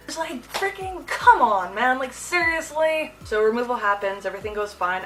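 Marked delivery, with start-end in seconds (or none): 0.1-3.1 exasperated. Retold or imitated. Exasperated at painful IV.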